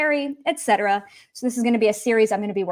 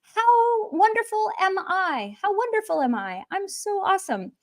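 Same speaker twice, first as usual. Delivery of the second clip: hammed-up boastful delight; self-admiring song character; 8 semitones higher than usual. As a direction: gush about yourself in a higher voice. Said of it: "So he's saying".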